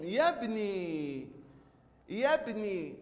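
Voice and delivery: يبني slowly, final nii held and falling; long final ii. Shown as a demonstration. Teacher modelling the word for pupils, long nii. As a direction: draw out the last vowel of يبني. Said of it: "نشبع النون بالكسرة".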